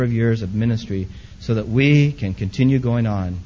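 steady, earnest pastoral prayer; closing prayer. Acted. Pastor praying for the church and leaders, earnest.

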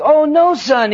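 high, loud burst of fatherly reassurance; very enthusiastic. Acted. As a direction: play up the eager, encouraging dad. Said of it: "the father was very enthusiastic".

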